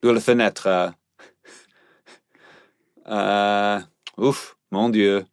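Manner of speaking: put-on lightly drunk French officer; mumbled French accent. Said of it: "be a French inebriated police officer".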